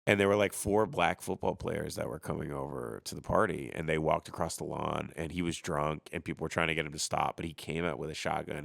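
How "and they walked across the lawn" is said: normal talking voice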